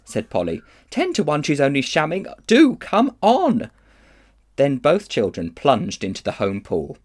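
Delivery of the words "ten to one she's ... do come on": impatient. Acted. Polly impatient.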